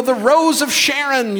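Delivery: high, loud pious proclamation; mock grand prayer. Preacher parodies flowery Old Testament praise, mock grand.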